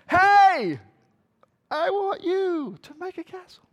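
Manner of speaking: high shouted command, easing in volume; yelling at the ants. Acted. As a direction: open on a big high "Hey". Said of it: "start yelling at the ants".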